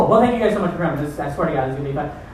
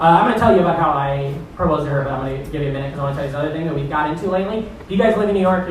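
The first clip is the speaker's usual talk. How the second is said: ordinary talk, nothing marked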